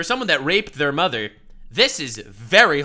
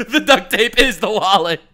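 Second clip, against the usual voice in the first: louder, laughing through the words; 2 semitones higher, 7 dB louder than usual. Real, not acted. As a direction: giggle it out. Still laughing at clip.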